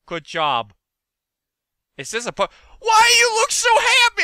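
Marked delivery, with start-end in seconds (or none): 2.8-4.2 loud, very high wail; high squealed shout. Real, not acted. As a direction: squeal it out in dismay. Alarmed at game creature.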